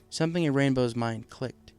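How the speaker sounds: plain reading voice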